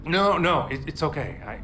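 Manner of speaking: high, hurried stammering; rushing to take it back; flustered apology; anxious reassurance. Acted. Apologising in distress.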